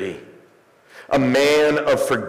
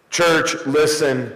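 normal talking voice

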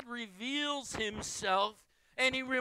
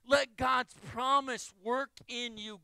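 ordinary talk

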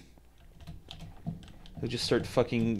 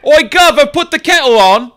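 high, loud, hammed-up British shout; badly faked British yell; bad British accent; 13 semitones higher, 16 dB louder than usual. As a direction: holler in a terrible mock-British voice. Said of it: "I don't usually do a British accent this badly".

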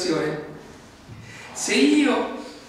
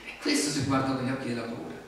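normal talking voice

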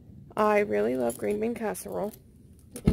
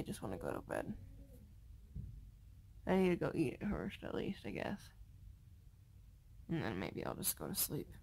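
sleepy; 5 semitones lower, 10 dB quieter than usual. Real, not acted. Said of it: "I'm really, really sleepy".

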